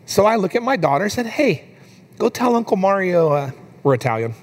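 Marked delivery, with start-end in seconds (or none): 1.3-3.3 put-on higher-pitched talking-to-a-toddler voice; lightly acted parent prompting a small child; dad coaxing toddler. Dad asks his little girl to apologize, gently coaxing.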